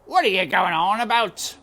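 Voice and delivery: loud surly man; loud, annoyed uncle voice; Uncle irritated. Acted. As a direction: snap it like a rough uncle. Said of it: "said Uncle".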